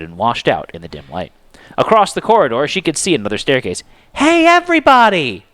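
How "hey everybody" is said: high, loud call out; shouting. A narrator's reading. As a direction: shout to the others across the hall. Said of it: "she shouted".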